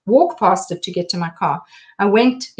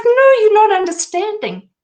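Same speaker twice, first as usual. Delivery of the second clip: high-pitched, louder, pleading refusal; a retold distraught cry of refusal; desperate protest; 11 semitones higher, 4 dB louder than usual. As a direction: push the gift away in distress. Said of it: "And I was like".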